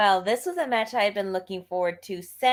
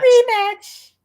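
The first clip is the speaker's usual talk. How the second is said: much higher, loud excited repeat; excited echo; 14 semitones higher, 11 dB louder than usual. Real, not acted. Cohost echoing the main event, excited and loud. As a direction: squeal the word back.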